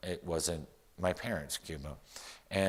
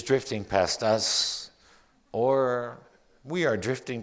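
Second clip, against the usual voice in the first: projected, deliberate poetry reading; poem recital; 3 semitones higher, 7 dB louder than usual. Acted. Poet watching Malta drift past the ship, poem recital. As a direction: declaim it, letting the images stretch out. Reads own poem aloud.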